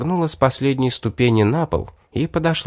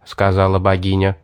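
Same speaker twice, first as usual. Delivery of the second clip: plain reading voice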